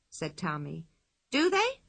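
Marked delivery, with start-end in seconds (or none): 1.3-1.8 high, surprised question. A narrator's reading. Pippi surprised by ants.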